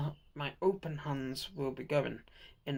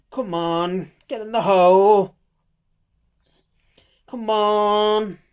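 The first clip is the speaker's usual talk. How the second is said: straining, shouting at the fiddly figure; loud exasperated come on; frustrated; 5 semitones higher, 14 dB louder, slower than usual. Real, not acted. Struggling to fit figure's hands.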